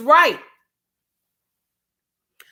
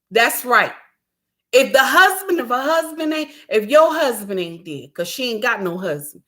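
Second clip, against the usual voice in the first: ordinary talk, nothing marked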